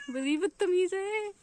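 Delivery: very high, slow pet-talk voice; playful scolding. Real, not acted. Owner playfully scolds the naughty pet, high slow coo.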